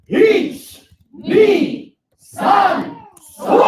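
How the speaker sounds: loud, high shouted count-in; big voice count. Shown as a demonstration. Teacher leads the class shouting the count-in. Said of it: "and sore in a big voice".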